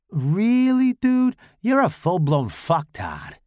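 high, loud scoffing voice; scornful Tommy. Acted. Tommy mocks Fred.

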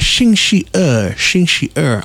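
imitating coworkers spelling out Xing Shi Er; Mandarin for Tuesday. Coworkers teaching him Tuesday, slow and careful in Mandarin. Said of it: "And they're like".

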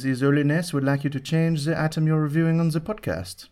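put-on French envoy accent; ridiculous French accent. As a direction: deliver an official's request in a hammed-up French accent. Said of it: "that's a ridiculous French accent".

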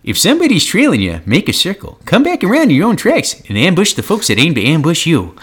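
high, loud prospector voice; old-timey prospector voice. Acted. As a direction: warn them like a grizzled old miner. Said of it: "in that prospector voice".

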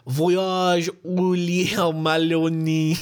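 slow, drawn-out attempt at French pronunciation; bad French accent. Podcaster fumbling through a French book title, bad French accent. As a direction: stretch out the title in clumsy mock French. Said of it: "My French is as good as my German".

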